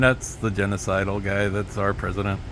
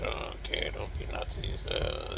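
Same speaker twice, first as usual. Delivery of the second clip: a tiny whispered mock obedience; meek whispered compliance, no voice at all; 14 dB quieter than usual. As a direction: breathe it out like a scolded child.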